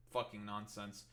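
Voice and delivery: ordinary delivery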